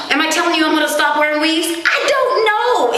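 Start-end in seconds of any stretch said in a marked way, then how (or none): 1.7-3.0 high, slow drawn-out uncertainty; helpless shrug. Real, not acted. Vlogger asked if she'll quit weaves, high drawn-out helplessness. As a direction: stretch it out, truly torn. Torn over wearing weaves.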